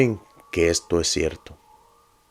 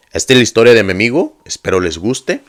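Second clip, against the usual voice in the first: louder, quicker title call; lively announcement; 5 semitones higher, 11 dB louder than usual. A narrator's reading. Narrator launching his friend's story for viewers, energetic.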